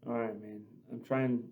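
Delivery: barely holding it together, voice dropping away; real emotion after hearing his friend's praise, holding back tears; choked up. Real, not acted. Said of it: "I'm trying real hard not to cry".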